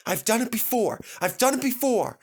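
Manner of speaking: encouraging puffs; puffing. Acted. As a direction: puff it out steadily. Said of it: "puffed Edward".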